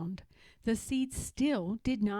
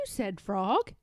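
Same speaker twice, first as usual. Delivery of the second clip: ordinary delivery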